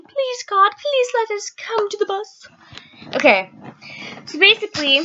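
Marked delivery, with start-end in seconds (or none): 0.0-2.5 high, drawn-out mock pleading; pleading prayer. Freezing kids praying for the bus, mock pleading. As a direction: beg heaven in a high, stretched voice. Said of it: "We're all praying".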